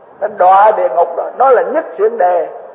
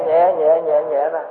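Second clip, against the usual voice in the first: "nhẹ" repeated lower and softer each time; soothing trail-off, softer and lower; fading softly; 5 semitones lower, 5 dB quieter, slower than usual. Shown as a demonstration. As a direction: let it die away to a hush. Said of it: "Từ từ cho nó nhẹ".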